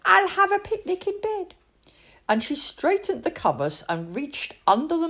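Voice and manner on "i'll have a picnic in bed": excited idea. A narrator's reading. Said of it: "cried the little princess".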